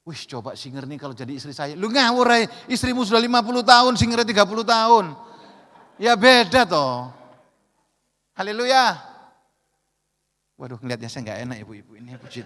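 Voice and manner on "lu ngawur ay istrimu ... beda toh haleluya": louder, higher preacher's call; full-force sermon delivery; forceful preaching. Real, not acted. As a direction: drive the point home to the pastors.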